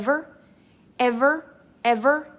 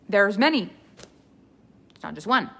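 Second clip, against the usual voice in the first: normal talking voice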